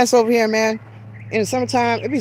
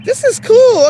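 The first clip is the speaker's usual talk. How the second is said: bursts up high and loud with delight; thrilled squeal at the new viewers; excited; 13 semitones higher, 6 dB louder than usual. Real, not acted. A creator thrilled that viewers joined, excited squeal. Thanking viewers joining her live.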